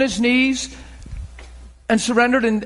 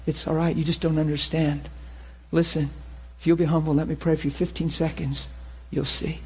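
gentle reassurance; 5 semitones lower, 7 dB quieter than usual. Acted. Gentle believer reassuring stranger.

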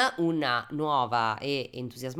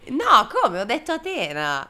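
indignant; 6 semitones higher, 5 dB louder than usual. Real, not acted. Protests losing the quiz.

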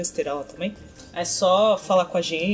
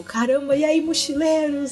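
high-pitched, hammed-up excitement; overjoyed greeting; 7 semitones higher than usual. Co-host acting out a happy listener's email, overjoyed. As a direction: imitate a thrilled fan almost shouting hello. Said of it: "Ele tá muito feliz de falar com a gente".

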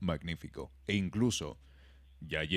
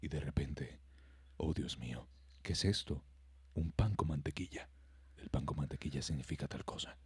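low, much softer murmured film dialogue; mock mumbling; 7 semitones lower, 13 dB quieter than usual. Mocking the film, muttering lines softly. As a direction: mumble like an inaudible movie scene. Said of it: "Y todo el mundo hablaba así".

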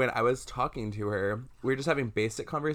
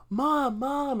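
calling mom, much higher and louder; retold yelling for mom; 5 dB louder than usual. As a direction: call out for mom in a panic. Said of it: "And she starts yelling".

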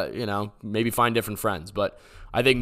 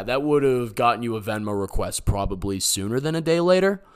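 normal talking voice

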